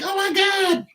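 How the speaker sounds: very high mock female cry; panicked woman. Imitating the woman during the fight, high panic. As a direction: gasp it out like a scared woman. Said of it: "in the back".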